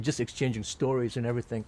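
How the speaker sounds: normal talking voice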